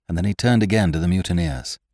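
usual speaking voice

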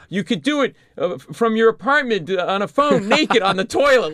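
high, loud, stumbling desperate offer; out-of-his-mind pleading. Retold or imitated. Podcaster begging a music legend for an interview, crazed high babble. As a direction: pile up offers with frantic eagerness. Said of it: "Like, I was out of my mind".

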